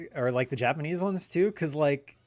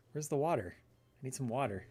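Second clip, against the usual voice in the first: ordinary talk, nothing marked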